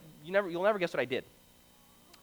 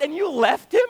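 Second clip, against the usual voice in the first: shocked dad; 6 semitones higher, 7 dB louder than usual. Retold or imitated. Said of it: "And so my dad".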